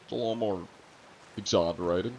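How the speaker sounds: exaggerated Australian accent. Shown as a demonstration. Streamer answering an Australian about accents, exaggerated Australian accent.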